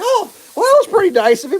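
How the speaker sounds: high sing-song sarcastic impression; sarcastic mock. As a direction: parody the meek crowd in a high, prissy voice. Mocking the expected reaction.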